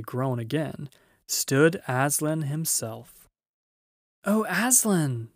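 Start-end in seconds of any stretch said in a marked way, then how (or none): 4.2-5.3 high, drawn-out cry of fright and gladness; amazed cry. A narrator's reading. Both children staring up at Aslan, amazed high cry. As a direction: cry out slowly, half frightened, half glad. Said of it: "cried both the children".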